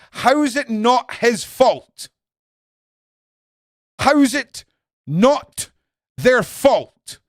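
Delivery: loud, high, slow furious yell; enraged shout. Real, not acted. Ranting to viewers about France, furious shout. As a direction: bellow the outrage at the viewers. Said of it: "I'm getting too enraged".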